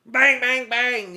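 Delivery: high, loud bangs; mock beating. Cop acting out the beating, loud and high. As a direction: yell each bang like a blow.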